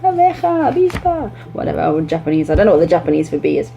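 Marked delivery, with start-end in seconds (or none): 0.0-1.3 very high eager Spanish words; niño diciendo abeja con entusiasmo; child's answer in Spanish. Retold or imitated. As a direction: call out like a keen child. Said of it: "say it in their language".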